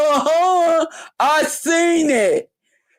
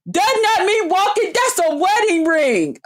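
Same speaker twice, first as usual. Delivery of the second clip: pitched up high in disbelief; incredulous; 7 semitones higher than usual. Retold or imitated. Retelling the live chat with the lady, high incredulous voice. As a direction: squeak out the question, then her high mock reply. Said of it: "I said".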